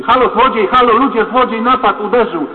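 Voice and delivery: loud, high street alarm shout; cry for help. Retold or imitated. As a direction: shout to passers-by that you've been robbed. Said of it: "ludzie potrafią krzyczeć". (głośne wołanie o pomoc na ulicy)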